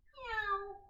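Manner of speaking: put-on high cat meow for the children. Shown as a demonstration. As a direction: mew like a playful cat. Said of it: "Remember how we did the cat".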